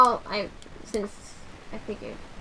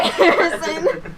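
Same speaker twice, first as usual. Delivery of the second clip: laughing; slower than usual. Real, not acted. Teasing laughing call.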